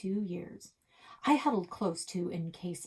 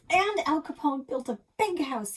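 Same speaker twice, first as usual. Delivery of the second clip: squealing; 10 semitones higher, 6 dB louder than usual. Acted. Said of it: "I squealed".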